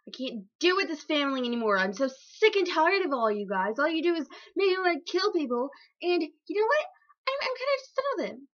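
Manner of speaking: mock meltdown, pitch rising as it goes; fed-up outburst. Chicken father quitting his family, fed-up high rant.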